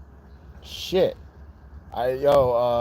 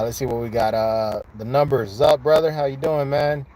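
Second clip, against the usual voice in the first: ordinary delivery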